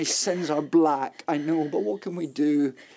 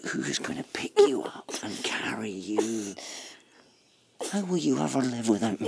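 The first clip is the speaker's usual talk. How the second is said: husband whispering playfully to his wife; teasing whisper; 2 semitones lower than usual. Acted. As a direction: whisper it in her ear.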